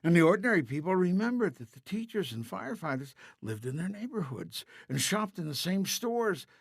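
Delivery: ordinary delivery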